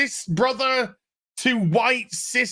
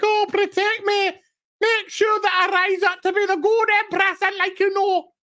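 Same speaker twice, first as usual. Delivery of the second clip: very high, whiny put-on voice; hammed-up damsel in distress; mock pleading Empress; 10 semitones higher than usual. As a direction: plead in a squeaky mocking falsetto.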